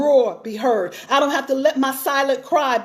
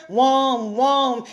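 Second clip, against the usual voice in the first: Charlie Brown droning. Retold or imitated. Mimicking a nagging woman as the man hears her, mock droning. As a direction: imitate a droning, wordless "wong" noise. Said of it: "You start sounding like Charlie Brown".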